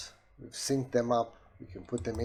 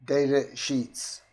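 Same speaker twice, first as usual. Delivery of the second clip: slow, louder, higher, words set apart; slow repeated command; 4 semitones higher, 5 dB louder, slower than usual. Shown as a demonstration. Repeating to unresponsive software, slow loud command. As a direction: spell it out for a machine that missed it.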